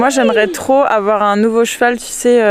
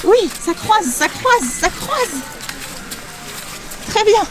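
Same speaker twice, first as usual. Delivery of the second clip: encouraging the horse; 8 semitones higher, 4 dB quieter, much slower than usual. Real, not acted. Rider encouraging horse sideways.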